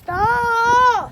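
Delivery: shout. Real, not acted. Kids snowball fight, child hit.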